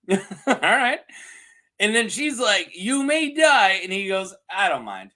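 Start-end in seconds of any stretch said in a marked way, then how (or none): 2.8-3.8 high, loud, slow pronouncement; mock grave warning. Host as queen warning her knight, mock grave. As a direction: draw it out with hammed-up drama.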